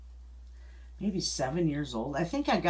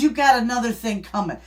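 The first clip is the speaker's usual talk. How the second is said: louder, higher indignant scolding, hands on hips; mother's indignant outburst; 6 semitones higher, 8 dB louder than usual. Retold or imitated. Quoting mother's scolding to husband, indignant and louder. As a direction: give him a piece of your mind, mock-stern. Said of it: "she put her hands on her hips".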